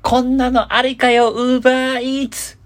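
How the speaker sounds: high, drawn-out title announcement; big high-pitched show announcer call. Pretend. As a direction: shout the segment title slowly, stretching the last word. Calls out the episode's theme title.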